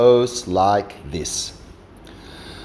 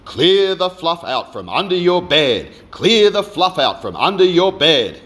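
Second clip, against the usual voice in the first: higher, quick smug chant; puffed-up gloating; 7 semitones higher, much faster than usual. Acted. Gloating big brother mimicking the parents' order. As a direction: lord it over him, puffed up. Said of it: "all puffed up".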